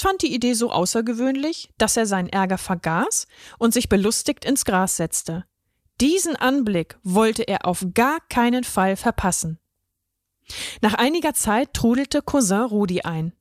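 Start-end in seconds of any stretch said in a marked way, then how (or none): none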